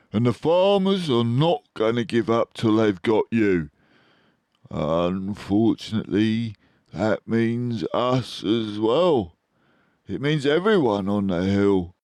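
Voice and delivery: mild anger. A narrator's reading. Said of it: "said Badger furiously".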